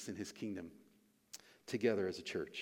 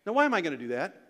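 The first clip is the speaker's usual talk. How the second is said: usual speaking voice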